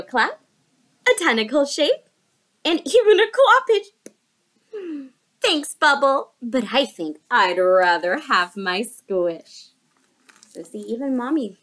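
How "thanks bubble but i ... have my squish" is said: louder, slower mother voice; lightly voiced loving mom; gentle mama. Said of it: "his mommy said".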